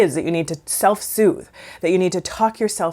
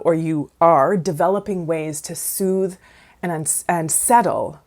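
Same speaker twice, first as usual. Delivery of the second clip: ordinary delivery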